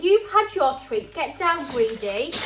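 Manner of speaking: high, loud telling-off of a pet; scolding the dog. Real, not acted. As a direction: shoo the greedy dog away firmly. Scolding the dog begging for food.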